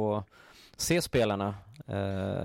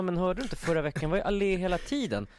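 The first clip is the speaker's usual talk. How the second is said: mock insistent; 9 semitones higher than usual. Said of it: "Jag bara".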